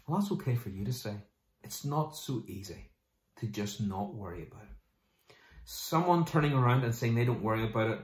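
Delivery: ordinary talk, nothing marked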